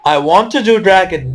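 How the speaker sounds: ordinary talk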